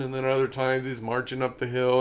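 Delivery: normal talking voice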